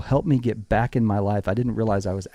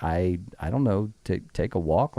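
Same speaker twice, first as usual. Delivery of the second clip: low, soft, halting shrug; unsure; 5 semitones lower, 5 dB quieter than usual. Retold or imitated. Wife asked about Friday nights, soft unsure answer. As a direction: stumble through a guess, dropped in pitch and volume. Wife's imagined clueless answer.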